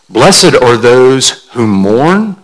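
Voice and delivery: "Blessed are those who mourn" drawn out, very slow and deliberate; slow scripture reading. Acted. Reading the Beatitude slowly to the congregation, solemn. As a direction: linger on each word of the verse.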